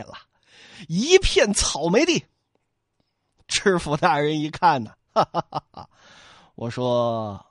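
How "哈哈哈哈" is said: prefect's laugh. Acted.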